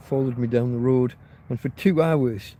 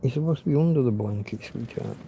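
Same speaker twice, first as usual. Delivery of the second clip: usual speaking voice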